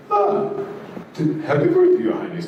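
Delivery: very high, sing-song servant's greeting. Retold or imitated. Servant wishing the prince happy birthday, very high and fawning.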